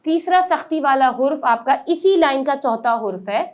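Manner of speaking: ordinary talk, nothing marked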